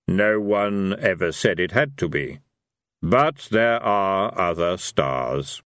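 slow, grave voice of Death; solemn Death. A narrator's reading. Death answering Vina's protest, slow and solemn. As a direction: drag out each word with finality.

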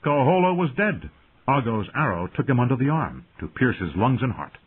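plain reading voice

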